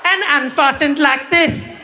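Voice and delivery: high, slow flight attendant announcement voice. Retold or imitated. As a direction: read it like a cabin crew safety demo. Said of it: "she's like".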